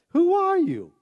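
high-pitched challenge; demanding questioning. Acted. Religious crowd confronting John the Baptist, demanding.

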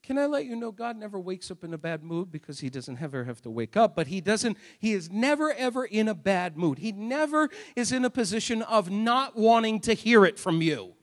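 usual speaking voice

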